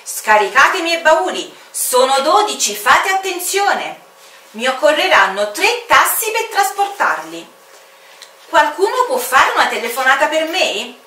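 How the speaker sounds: a merry stranger giving orders; cheerful orders from the mysterious pilot; bossy aviator. Acted. Aviator gives orders on landing.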